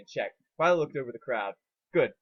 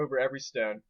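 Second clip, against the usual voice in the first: ordinary delivery, nothing marked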